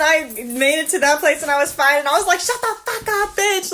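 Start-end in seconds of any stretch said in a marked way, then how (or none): 2.4-3.7 exasperated cursing, held short of screaming; unscreamed angry retort. Retold or imitated. Driver retells cursing at honker, high, held-back anger. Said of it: "I didn't actually scream it".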